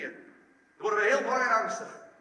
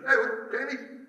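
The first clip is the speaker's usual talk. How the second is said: mock denial; 5 semitones higher, 5 dB louder than usual. Acts Peter denying to girl.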